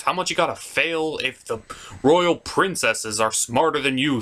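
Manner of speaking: ordinary talk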